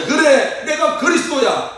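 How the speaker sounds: high, exultant announcement; imagined triumphant Jesus. Acted. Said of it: "예수님께서 갑자기". (의기양양하게 높은 목소리로 선언)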